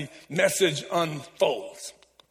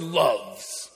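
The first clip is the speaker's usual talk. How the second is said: lower, lingering "loves"; stressed word; 7 semitones lower than usual. Shown as a demonstration. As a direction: lean into "loves" for the crowd. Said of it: "Say this word".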